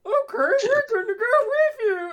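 very high squeaky puppet voice; pinched high cartoon puppet; goofy muffled sock puppet. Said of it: "And I just do it like that".

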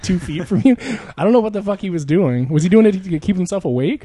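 normal talking voice

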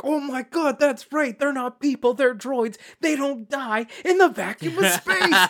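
high, loud, laughing rush of realization; excited realization. Retold or imitated. Fan reliving the droid twist to a co-host, laughing excitedly. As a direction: replay the moment it clicked, squealing with glee. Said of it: "I'm like".

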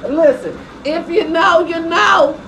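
high, loud knowing call; excited. Real, not acted. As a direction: belt it out knowingly. Emphatic high loud aside.

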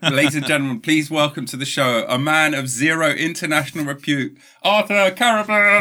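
mock grand show announcer welcome; announcer intro. Host introducing his podcast guest as a showbiz announcer. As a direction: ham up the big showbiz welcome. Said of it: "How was that?".

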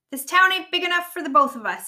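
high, loud cowboy challenge; yelled. A narrator's reading. Rabbit fighting Ribbit over the hat, yelling. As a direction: yell it like a showdown threat. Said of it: "he yelled".

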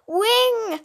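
high, loud cry of Wing; brother screaming. Acted. Brother screams as Wing falls, high and loud. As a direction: call out in alarm. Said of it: "hörte ich meinen Bruder schreien".

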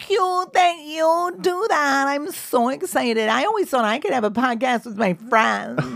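mock girly excitement, stretched vowels; giggly silly girl. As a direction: gush like a silly girl thrilled by a text. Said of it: "that giggly, silly girl shit".

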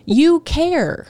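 high, disbelieving put-on voice; incredulous mock. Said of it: "it's like".

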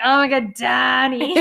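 loud, hammed-up exclamation; excited gushing. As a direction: gush over the name at full volume. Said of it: "But then I started writing".

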